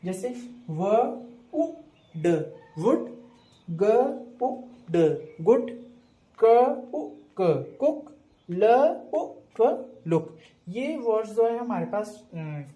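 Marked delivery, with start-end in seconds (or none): 0.0-10.4 slow sounds then word with short oo; phonics short oo blending. Shown as a demonstration. Teacher to kids, sounding out short oo words slowly. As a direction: sound out each letter, short oo in the middle. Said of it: "छोटे ऊ की sound".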